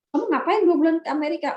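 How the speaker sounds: high, loud, quick mock interrogation; imitated incredulous questioning. Boyfriend's mother quizzing a young traveller, imitated incredulous questioning.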